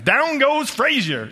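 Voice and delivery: high, drawn-out sportscaster call; loud, stretched-out boxing commentary impression; Howard Cosell. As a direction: call the knockdown like an excited ringside announcer. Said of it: "If Howard Cosell was gonna announce this".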